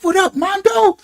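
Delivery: high, cheery what-up; upbeat greeting. Retold or imitated. Answering his friend's call, high cheery greeting. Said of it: "So I'm like".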